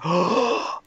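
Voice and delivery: re-enacted gasp of wonder; amazed gasp. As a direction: gasp in awe, as if retelling the moment. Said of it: "I was in such amazement".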